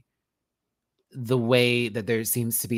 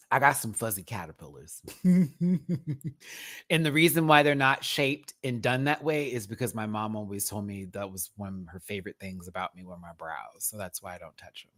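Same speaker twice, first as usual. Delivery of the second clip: normal talking voice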